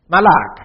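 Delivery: "Malak" said as ma-LAK with a clear final k; Hebrew word malak. Shown as a demonstration. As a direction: spell out "Malak" clearly, two syllables. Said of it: "the Hebrew word malak".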